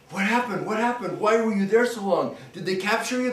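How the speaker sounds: high, eager rapid questions; excited villagers. Acted. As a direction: pepper the ghost with worried questions. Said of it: "And everyone goes".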